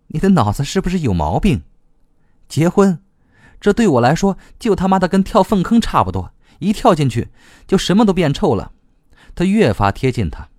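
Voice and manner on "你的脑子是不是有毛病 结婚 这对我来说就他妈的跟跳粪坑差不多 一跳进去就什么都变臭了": high-pitched indignant rant; scornful outburst. A narrator's reading. Rejecting a sudden marriage proposal, indignant. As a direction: throw the proposal back, pitched well up.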